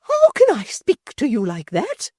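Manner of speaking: high, loud, slow scornful echo; high indignant retort. A narrator's reading. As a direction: fling the question back at her. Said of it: "retorted Missus Chick".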